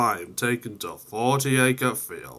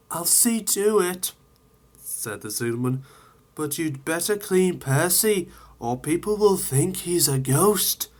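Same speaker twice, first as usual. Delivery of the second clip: higher, lightly voiced helpful railwayman; signalman's advice; 7 semitones higher than usual. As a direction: give friendly advice. Signalman advises the crew.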